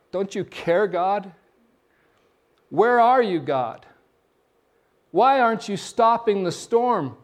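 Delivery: pleading cry, rising to a shout on where are you; a desperate plea flung at God; anguished cry to God. Acted. Worshipper crying out to God in pain, desperate shout.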